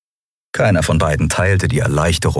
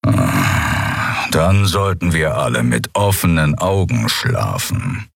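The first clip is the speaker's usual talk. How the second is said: menacing beast voice; 2 semitones lower, slower than usual. Acted. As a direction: rumble it like a dangerous predator. Keru, bedrohlicher Skrier. (tiefe, grollende Stimme eines gefährlichen Raubwesens)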